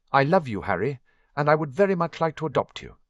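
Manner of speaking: a godfather nervously blurting an adoption offer, fast; quickly. A narrator's reading. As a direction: blurt it out before losing nerve. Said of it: "Sirius said quickly".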